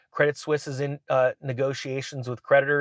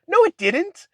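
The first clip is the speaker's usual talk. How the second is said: much higher, louder playful protest; mock denial; 6 dB louder, slower than usual. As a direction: squeal a childish no. Friend flatly denying the crash.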